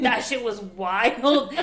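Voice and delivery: slow, loud laughing words; real laughing. Real, not acted. Looking back on her own breakdown, laughing loudly. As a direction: laugh through a drawn-out verdict.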